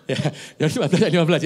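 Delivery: fast, laughing correction. Real, not acted. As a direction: rush through it laughing at the slip. Laughing at own slip of reading. (sambil tertawa cepat)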